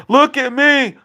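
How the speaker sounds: imitating dad crowing high and loud; mocking dad's boast. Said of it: "You said to Joe Biden".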